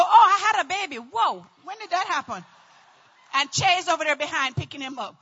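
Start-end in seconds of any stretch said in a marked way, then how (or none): 0.0-2.4 loud, exclaimed comic astonishment; mock surprise. Easy-birthing woman noticing her baby, mock surprise. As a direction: imitate someone amazed by an accident.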